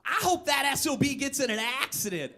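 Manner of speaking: high, hammed-up curse; shrill outraged driver wish; mock spiteful yell. Drivers on seventy-seven yelling at him, mock rage. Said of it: "who yell".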